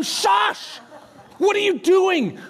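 high-pitched mock reprimand; scolding shush. Crowd telling the blind beggar off, scolding. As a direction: hush him, then scold. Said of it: "They tell him".

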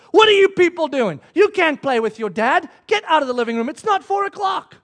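loud, acted-out telling-off; mock scolding. Preacher voices wife ordering husband out of living room, mock scolding. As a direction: scold them like an indignant wife.

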